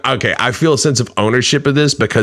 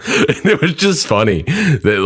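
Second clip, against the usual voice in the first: laughing; 7 semitones higher than usual. Real, not acted. Laughing while recalling comments.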